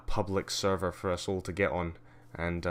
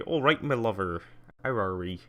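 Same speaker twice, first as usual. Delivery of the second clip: put-on Cornish accent; 2 semitones higher, 5 dB louder than usual. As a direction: greet them like a humble Cornish farmer. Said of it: "the Cornish are the".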